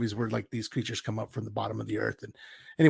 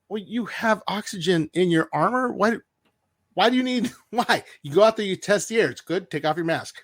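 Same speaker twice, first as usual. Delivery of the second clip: higher, louder mocking disbelief; mock incredulous; 7 semitones higher, 5 dB louder than usual. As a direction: poke fun at the logic, pitched up. Mocking Mando's helmet logic.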